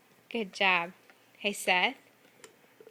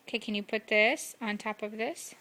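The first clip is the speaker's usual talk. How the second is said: ordinary delivery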